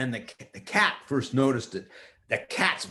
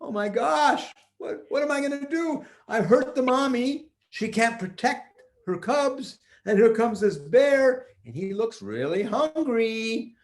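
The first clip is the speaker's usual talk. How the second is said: put-on high, anxious young boy; panicked boy; 6 semitones higher than usual. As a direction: fret in a rush. Peter panicking.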